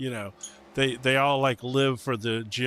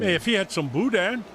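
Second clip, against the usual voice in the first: put-on higher, sing-song Cajun accent; 8 semitones higher than usual. Craving boudin, in a Cajun accent. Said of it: "then I've got my Cajun voice".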